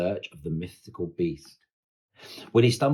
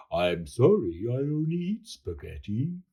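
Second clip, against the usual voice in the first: Yeti voice. A narrator's reading. The Yeti finally speaks.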